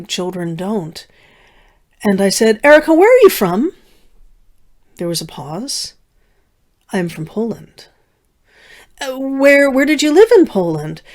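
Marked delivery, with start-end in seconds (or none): 2.6-3.7 put-on higher, louder, quick child's question; eager child asking her elder friend; childlike blurted question. 6.9-7.9 softer, guarded reply; a reserved woman answers a child, softly guarded; reserved quiet answer. Retold or imitated. Erica answers after a pause. 9.0-11.0 put-on higher, eager child voice; curious child presses her elder friend; childlike pressing question.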